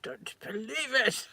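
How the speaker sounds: hammed-up indignant disbelief; Victor Meldrew. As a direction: do a comic outraged old grump. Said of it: "I just love Victor Meldrew".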